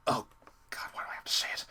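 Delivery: whispered grumble; exasperated whisper to yourself; very quiet, voiceless complaint; muttered aside. Real, not acted. Exasperated aside after stumbling.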